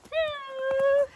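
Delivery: high, building cheers for the snowman; delighted mother cheering her son's snowman; excited whoops. Real, not acted. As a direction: whoop with joy at the snow.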